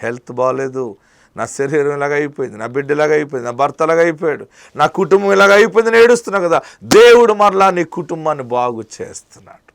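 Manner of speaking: rising from plain to loud, high fervent preaching; building preaching. Acted. Preacher voicing believers' troubles, building to loud fervor. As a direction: build the list of troubles into a shouted climax.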